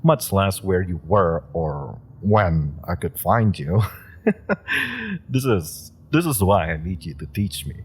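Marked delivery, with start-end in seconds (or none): 4.2-5.2 short high giggle. Acted. Laugh before pleading for lessons.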